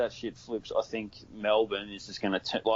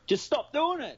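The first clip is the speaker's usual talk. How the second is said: exasperated plea. Real, not acted. An Aussie pleading with American commentators, high and exasperated. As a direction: beg them to give it up, pitched way up. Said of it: "pleading Americans to stop".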